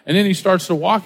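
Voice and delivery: ordinary talk, nothing marked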